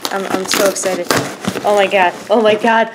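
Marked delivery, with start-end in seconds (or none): none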